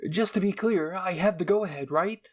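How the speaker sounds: high, loud eager question; can't wait to experiment; a mad scientist itching to start; eager Hanji. Acted.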